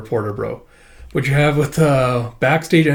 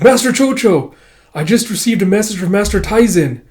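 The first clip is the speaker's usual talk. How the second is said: high nervous messenger; 7 semitones higher, 5 dB louder than usual. Acted. Messenger reporting to Chocho.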